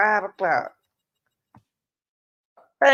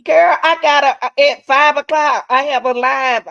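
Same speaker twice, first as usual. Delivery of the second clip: loud, high burst of disbelief; really agitated, voice raised; worked up; 7 semitones higher, 10 dB louder than usual. Real, not acted. Worked up over the shocking coincidence.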